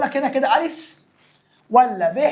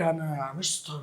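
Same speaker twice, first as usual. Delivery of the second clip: a hint of a small, much softer student voice; eager student; 7 semitones lower, 13 dB quieter than usual. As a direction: lightly voice a keen pupil. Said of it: "يقول لك".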